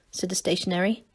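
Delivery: usual speaking voice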